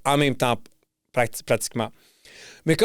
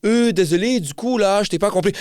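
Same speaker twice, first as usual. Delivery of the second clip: higher-pitched mock France French accent; 4 semitones higher than usual. French people feigning incomprehension to a Québécois, mock France French accent. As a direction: imitate an apologetic Parisian-style French accent. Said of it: "je vais en France".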